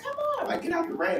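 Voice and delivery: very high-pitched urging; high mock holler to come inside; imitated calling out. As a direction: mimic friends beckoning from a doorway. People calling him out of rain.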